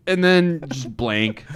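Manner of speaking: normal talking voice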